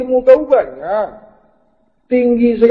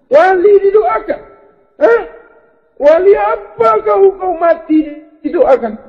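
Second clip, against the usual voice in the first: mocking disbelief; 9 semitones higher than usual. Real, not acted. Mocking the dead wali prayed for.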